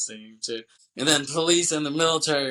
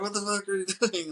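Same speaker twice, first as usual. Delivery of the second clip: high, quick imitated protest with a laugh; hammed-up disbelief, pitched way up; mock outrage; 9 semitones higher, faster than usual. Sandino as crane stops plane, mock outraged protest.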